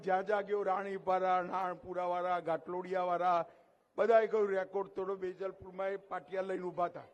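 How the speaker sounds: ordinary delivery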